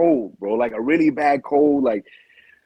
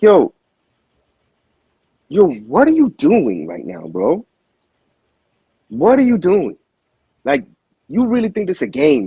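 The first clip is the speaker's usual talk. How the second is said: exasperated confrontation; 6 semitones higher than usual. Retold or imitated. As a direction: call him out as if he's throwing it away. Confronting the boxer in Vegas.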